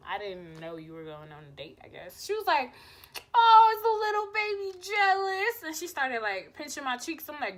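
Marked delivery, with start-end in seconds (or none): 0.1-2.2 low, very soft backpedalling; sheepish mumble. Retold or imitated. Covering up surprise to crush, sheepish low mumble. 3.3-5.6 high, mocking coo as if to a baby; teasing baby talk. Crush pinching cheeks, teasing high baby-talk coo.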